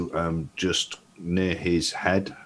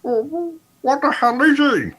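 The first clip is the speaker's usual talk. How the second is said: much higher bewildered voice; bewildered Studley; 5 dB louder than usual. Acted. Studley turning to Ida, a high bewildered demand. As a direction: demand it in disbelief. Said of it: "turn around to Ida and go".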